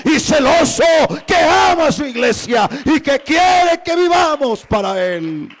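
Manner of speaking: loud, high, chanting the repeated aquí es; fiery preaching. Real, not acted. Pastor crying out to the church about grace. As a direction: preach it full force like a revival.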